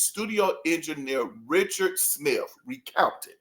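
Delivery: normal talking voice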